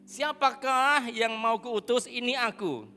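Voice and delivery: solemn Bible reading; slow scripture reading. Acted. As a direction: stretch each word of the verse. Preacher reads scripture slowly.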